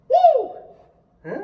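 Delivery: mock wolf-whistle woo, high and loud; hammed-up delighted double take; leering woo. Jacob ogling the sisters, a high hammed-up woo. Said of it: "So apparently he was like".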